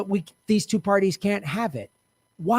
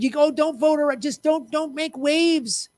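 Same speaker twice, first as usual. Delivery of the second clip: mock voter; 8 semitones higher than usual. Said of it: "had they said".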